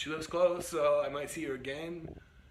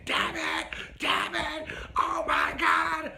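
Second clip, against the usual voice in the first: loud, high mock scream, drawn out at the end; 8 dB louder, much slower than usual. Driver imitating the woman in the back seat, screaming. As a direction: imitate a passenger shrieking curses. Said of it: "she's in the back seat screaming".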